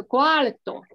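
cuarto said slowly, r right after the a; teacher sets cuarto against cuatro for a student; careful cuarto. Shown as a demonstration. Slow model of cuarto. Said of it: "不一样".

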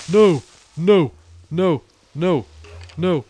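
high, loud panicked no no no; real panic as a mob attacks; panicked cries. Real, not acted.

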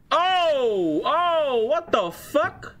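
high, drawn-out disbelief; shocked exclamation. Real, not acted. Streamer reacting to breaking news, shocked and high. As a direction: stretch it out high, stunned by what you see.

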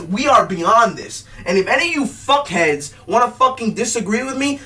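ordinary delivery

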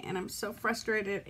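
usual speaking voice

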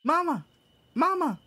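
put-on high, loud little child calling; high-pitched kid's cry for attention; tot voice. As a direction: call for mom as an excited small kid. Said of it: "What's a good voice for this tot?".

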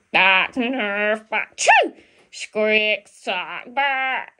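barking like a badger, mock animal noises; a playful imitated badger bark. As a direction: bark it out in short animal yaps. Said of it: "your best badger bark".

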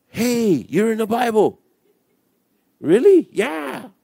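high, eager teasing voices; excited mock exchange. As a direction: play both sides high and keen. Acted exchange with a scoffer.

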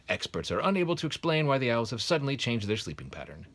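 bright, high, loud TV news read; newscaster. Acted. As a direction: announce it like an evening newsreader. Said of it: "The newscaster allowed himself a grin".

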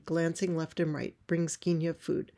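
usual speaking voice

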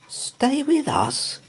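high, slow nervous repeat. A narrator's reading. Mr Brown to his wife, nervously repeating. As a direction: echo it back, alarmed. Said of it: "repeated Mister Brown nervously".

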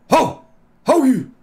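put-on high, loud shout at the kids; yelling hey. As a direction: call them off sharply. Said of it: "I went like".

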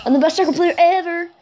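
high, excited boast; freaking out. Real, not acted. Player bragging to viewers after a goal, high and excited. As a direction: crow it high over your win. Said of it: "I'm freaking out here".

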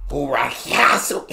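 mock German with a throat-clearing rasp; throat-clearing German imitation. Reactor mimicking the German speaker's word, throat-clearing rasp. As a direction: draw it out like clearing your throat. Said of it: "He clearing his throat, bro".

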